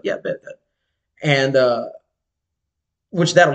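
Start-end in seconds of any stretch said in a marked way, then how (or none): 0.0-0.6 breathy whispered "yeah, bet, bet"; whispered reply. Retold or imitated. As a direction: toss it off in a whisper. Girl answering him casually.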